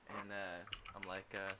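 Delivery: ordinary delivery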